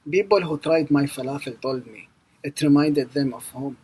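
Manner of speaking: normal talking voice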